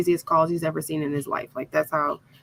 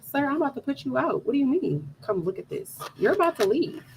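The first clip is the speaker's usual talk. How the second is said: high, quick, softer retort; mock indignation; 6 semitones higher, 4 dB quieter, faster than usual. Wife threatening to put husband out, mock indignation. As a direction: fire back in exasperated disbelief, pitched up.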